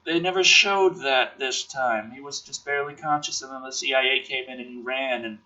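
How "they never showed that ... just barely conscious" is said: ordinary talk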